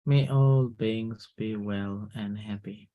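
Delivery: slow, soft meditation voice; guided meditation. Acted. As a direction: gently wish them well. Leads a loving-kindness meditation.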